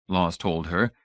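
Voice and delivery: usual speaking voice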